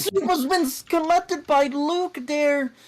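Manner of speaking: high, urgent commentary stretching out at the end; excited collision call. Real, not acted. Commentator seeing a slowed car get hit, excited.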